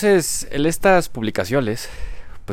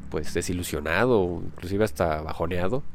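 ordinary talk, nothing marked

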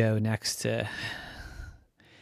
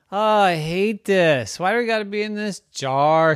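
loud, higher-pitched griping car; Rocky complaining; 8 semitones higher, 7 dB louder than usual. Acted. Rocky grumbles about being in the jar, complaining. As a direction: whine it out loud and high.

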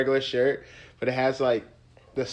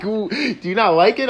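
higher, amused mock question; laughing imitation of girlfriend; 6 semitones higher, 3 dB louder than usual. Imitating his girlfriend asking about her outfit, amused. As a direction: toss it off lightly through a laugh. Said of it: "She was like".

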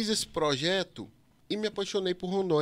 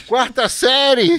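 slow loud teasing shout; 7 semitones higher, 13 dB louder, much slower than usual. Pretend. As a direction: call it out, mocking. Teasing shout about childishness.

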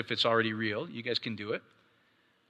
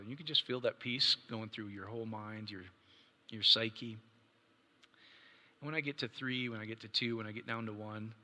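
guided prayer; 3 semitones lower, 9 dB quieter than usual. Acted. Pastor counting the congregation down into prayer, hushed and soothing. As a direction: murmur the countdown to calm the room.

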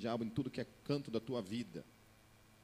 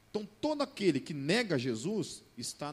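loud fervent preaching voice; pregação inflamada; 5 semitones higher, 9 dB louder than usual. Acted. Preaching at full force. (pregação em voz alta e fervorosa)

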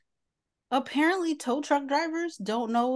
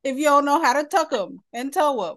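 laughing, louder, higher; 5 semitones higher, 7 dB louder than usual. Pretend. Joking about the tow driver while laughing. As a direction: laugh through the joke line.

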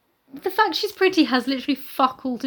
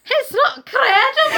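loud, high, drawn-out Scottish yell; mock-dramatic Scottish protest; Raglan; 11 semitones higher, 6 dB louder, slower than usual. Co-host echoing the inspector, hammed-up Scottish outrage. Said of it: "he's Raglan and Scottish".

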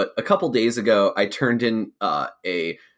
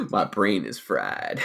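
low and slow; 6 semitones lower, 4 dB quieter, slower than usual. Pretend. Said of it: "This one's gonna sound interesting".

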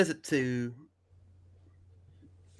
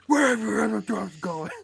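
higher, strained hero interrogation; Batman; 6 semitones higher than usual. Retold or imitated. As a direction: demand it in a put-on hero voice. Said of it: "he throws him over the ledge".

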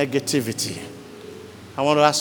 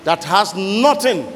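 loud, higher preaching climax; full-force preaching; 4 semitones higher, 3 dB louder than usual. Acted. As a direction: hammer each phrase at the congregation.

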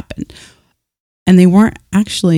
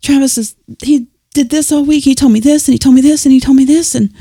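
mock venting, higher; 9 semitones higher, 4 dB louder than usual. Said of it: "I'm like".

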